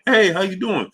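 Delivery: high, cheery mock hello; chirpy stranger greeting. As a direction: sing it out like an overly friendly passer-by. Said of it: "like, walking and".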